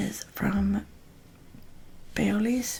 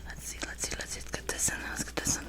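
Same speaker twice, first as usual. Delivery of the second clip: ASMR whisper; 10 dB quieter than usual. Real, not acted.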